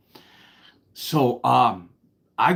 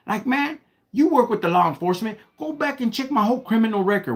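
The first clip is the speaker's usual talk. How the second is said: higher, building indignant rant; worked-up pleading with the officer; indignant protest; 6 semitones higher than usual. Retold or imitated. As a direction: argue your case like you're wronged.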